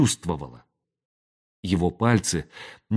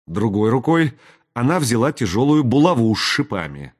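plain reading voice

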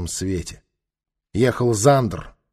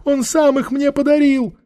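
very high, whining crone voice; whimpering old woman; 4 dB louder than usual. A narrator's reading. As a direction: whine out a plea of innocence. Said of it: "захныкала она".

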